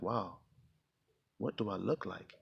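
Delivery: inner wonder. Retold or imitated. Wondering about his own look.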